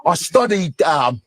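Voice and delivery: mock British accent; British actor giving an interview, hammed-up accent; Idris Elba. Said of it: "then I'm watching B B C".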